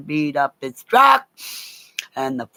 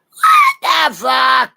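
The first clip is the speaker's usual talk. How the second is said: higher, louder, slow disbelieving shout; outraged shout; 10 semitones higher, 7 dB louder, much slower than usual. Retold or imitated. To the cop who takes her away, a slow shocked shout. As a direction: yell it out in disbelief. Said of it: "I'm like".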